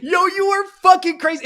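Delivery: high, loud laughing retort. Retold or imitated. Streamer laughing at ex-girlfriend's text, laughing high and loud. Retold reply while laughing.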